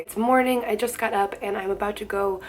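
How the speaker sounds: ordinary delivery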